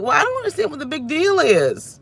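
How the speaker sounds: high, airy mock voice of people brushing it off; mocking dismissive people. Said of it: "everybody else be like".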